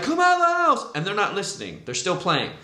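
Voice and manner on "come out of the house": put-on high, urgent shout at the kids; frantic yell. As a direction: yell it as a panicked dad. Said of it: "he's just like".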